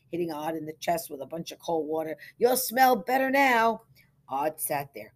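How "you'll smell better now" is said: high, loud, slow teasing; jovial ribbing. A narrator's reading. Simpson douses Odd with water, jovial teasing. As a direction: tease him with hearty good humour. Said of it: "laughed Mister Simpson".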